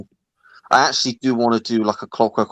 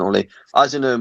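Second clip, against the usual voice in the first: ordinary talk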